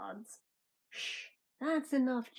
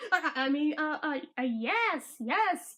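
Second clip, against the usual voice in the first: usual speaking voice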